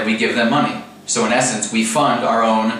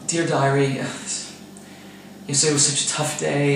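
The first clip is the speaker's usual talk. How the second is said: mock diary voice; 5 dB quieter than usual.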